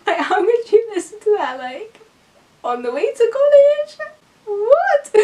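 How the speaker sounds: squeaky high, laughing what; incredulous disbelief. Real, not acted. Ridiculing people who play it on the commute, high and incredulous. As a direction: pitch way up, mocking and appalled. Mocking drum and bass listeners.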